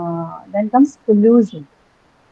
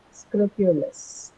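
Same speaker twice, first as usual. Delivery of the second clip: scrupulous slowly and softer, each syllable apart; 6 dB quieter, much slower than usual. Shown as a demonstration. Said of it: "your three syllables".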